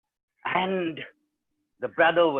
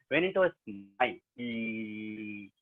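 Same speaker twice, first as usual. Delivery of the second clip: usual speaking voice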